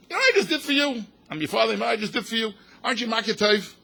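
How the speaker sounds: high, loud indignant parent; a father scolding his child for ingratitude, indignant and loud; mock demanding father. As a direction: play the offended father demanding thanks.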